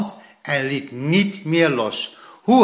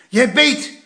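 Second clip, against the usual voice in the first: higher, louder urgent call; excited fishing-grandpa shout; a bit excited; 8 semitones higher, 3 dB louder than usual. A narrator's reading. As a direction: grandpa hurrying the boy on. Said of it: "zei opa een beetje opgewonden".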